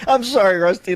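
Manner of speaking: ordinary delivery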